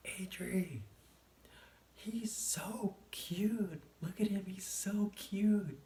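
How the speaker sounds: high-pitched, drawn-out gushing yell; imitating own yelling. High teller yells to friend at the register that cashier is cute, mock yelling. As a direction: stretch it out high, like a stoned girl with no volume control. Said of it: "yelling that the cashier is cute".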